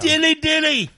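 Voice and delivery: high, loud mock proclamation; hammed-up herald call. Repeating the catchphrase for fun.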